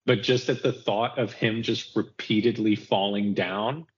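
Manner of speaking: ordinary talk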